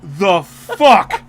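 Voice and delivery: slow, loud, thundering voice from the sky; booming God voice. Retold or imitated. Husband retelling dream, God booming at him. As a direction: boom it down from the heavens. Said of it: "God's voice going".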